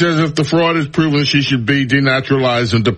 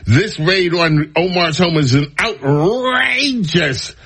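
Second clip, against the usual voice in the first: drawn-out, higher-pitched mock outrage; 4 semitones higher, slower than usual. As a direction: ham it up, stretching "outrageous". Mocking read of outraged Democrat statement.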